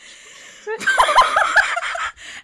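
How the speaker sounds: very high, loud shrieking laugh. Real, not acted. Shrieking laugh as she tells her friend the classmate failed.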